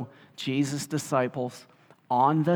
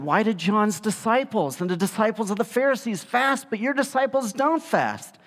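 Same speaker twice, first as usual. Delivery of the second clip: voicing questioners; 7 semitones higher than usual. Disciples of John questioning Jesus, high pointed question. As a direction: ask with a lightly voiced challenging edge. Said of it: "came to him and said".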